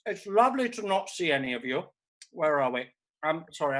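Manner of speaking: ordinary talk, nothing marked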